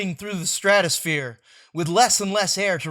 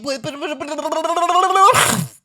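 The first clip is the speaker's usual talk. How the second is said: drawn-out chant; 8 semitones higher, 7 dB louder, much slower than usual. Acted. Stretched end of chant.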